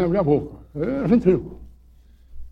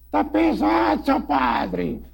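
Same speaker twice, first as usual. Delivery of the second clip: voz de mula bem aguda e estranha; voz da mulinha; 9 semitones higher, 3 dB quieter, much slower than usual. Retold or imitated. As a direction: imita a mula falando alto e fino. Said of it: "E fez a voz da, da mulinha lá". (padre ventríloquo faz a mula responder, voz aguda)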